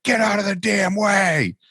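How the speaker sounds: meek lady finally loses it and bellows; mock yell of the meek lady who has had enough; sudden shout. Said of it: "eventually she's like".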